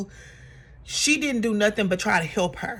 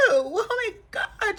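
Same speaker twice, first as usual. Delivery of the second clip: exasperated; 11 semitones higher, 3 dB quieter, slower than usual. Real, not acted. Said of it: "she's so dramatic".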